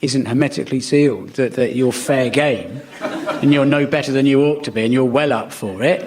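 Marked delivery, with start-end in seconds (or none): none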